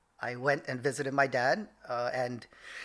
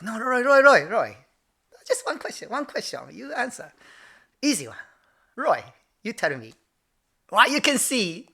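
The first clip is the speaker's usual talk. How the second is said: insistent imitated Japanese accent, high-pitched swoops; dad's Japanese accent; 7 semitones higher, 7 dB louder than usual. Dad pressing son for an answer, Japanese accent. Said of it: "I have to do it in his accent".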